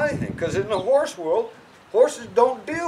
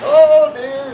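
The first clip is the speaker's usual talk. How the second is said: mock worried; 8 semitones higher, 12 dB louder than usual. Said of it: "when you're worried about it".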